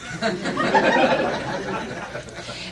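breathy, high laugh. Real, not acted. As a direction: burst into laughter. Laughs at Peter's answer.

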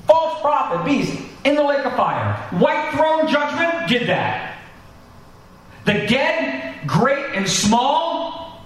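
high, ringing pulpit voice, slow on the key phrases; fervent preaching. Acted. Pastor to congregation, picturing Jesus doing it all, fervent preaching. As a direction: preach the final judgment like a vision unfolding.

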